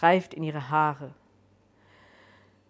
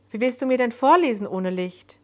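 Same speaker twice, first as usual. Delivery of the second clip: high, louder, puzzled challenge; incredulous question; 6 semitones higher, 5 dB louder than usual. A narrator's reading. Joan asking how one reads without light, incredulous. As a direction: raise the voice in disbelief.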